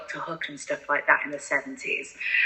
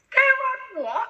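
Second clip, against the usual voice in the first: much higher, incredulous squeak; shocked disbelief; slower than usual. Retold or imitated. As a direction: act floored by the number. Shocked at tribute singer pay.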